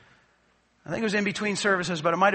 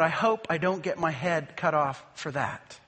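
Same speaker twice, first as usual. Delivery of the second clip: under her breath; 6 semitones lower, 6 dB quieter than usual. Retold or imitated. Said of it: "she said under her breath".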